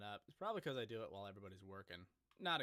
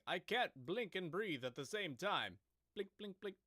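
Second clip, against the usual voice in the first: a lightly voiced cartoon hero, slower and a bit louder; half-hearted cartoon superhero impression, rising higher on the blinks; Rocko's Modern Life superhero; 7 semitones higher, 5 dB louder than usual. Streamer quoting a cartoon superhero's one-liner, lightly voiced. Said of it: "And he gasps for air after".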